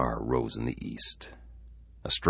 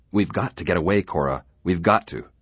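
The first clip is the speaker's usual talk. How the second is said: urgent insistence; 5 semitones higher, 7 dB louder than usual. Acted. Husband urging wife to flee.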